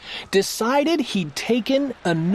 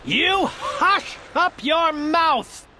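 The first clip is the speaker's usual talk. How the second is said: howled; 5 semitones higher, slower than usual. Acted. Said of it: "howled the mighty King Yertle".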